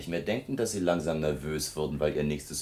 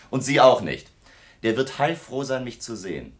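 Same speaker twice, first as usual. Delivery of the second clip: laut auftrumpfend; 7 semitones higher, 7 dB louder than usual. Acted. Student mocks the secretary.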